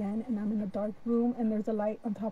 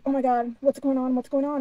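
louder, urgent repeated questions; retold panic; 3 semitones higher, 8 dB louder than usual. Abductee reliving waking beside an alien, panicked. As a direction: act out freaking out, voice raised. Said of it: "And I'm freaking out".

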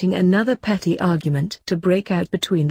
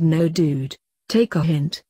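plain reading voice

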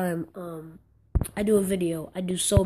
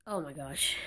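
ordinary talk

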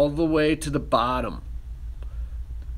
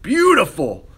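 high, loud "Beautiful" with a falling finish; delighted, pleased with the work; excited praise; 6 semitones higher, 5 dB louder, slower than usual. Real, not acted. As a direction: admire the finished pumpkin out loud. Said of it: "look at that bad biscuit".

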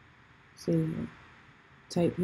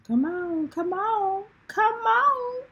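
rising urging; 11 semitones higher, slower than usual. Real, not acted. Urging, rising each time.

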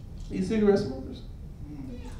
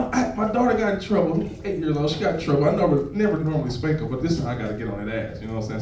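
normal talking voice

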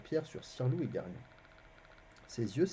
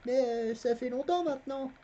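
put-on boy lying; 13 semitones higher, 4 dB louder than usual. A narrator's reading. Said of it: "mentit Garion".

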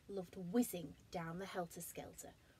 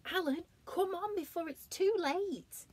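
urgent giraffe voice; 11 semitones higher, 5 dB louder than usual. Acted. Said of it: "said Giraffe".

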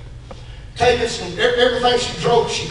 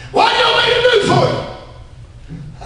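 high, slow, stretched question; calling out a question; 11 semitones higher than usual. Acted. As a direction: call it out slowly to the beggar. Jesus questions the blind man.

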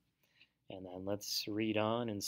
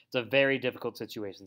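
ordinary delivery

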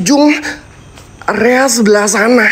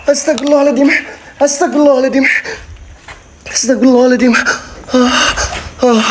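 higher, rushed panicky prayers; frightened praying; 5 semitones higher, faster than usual. Real, not acted. Vlogger spooked at the haunted spot, praying in panic. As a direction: gasp out prayers in fear.

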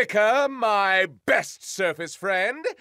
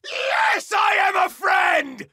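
screaming; 9 semitones higher, 4 dB louder than usual. Acted. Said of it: "Rick screamed".

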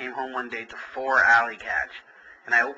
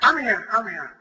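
eager reply; 10 semitones higher, 6 dB louder than usual. Real, not acted. Back at phone, louder, higher.

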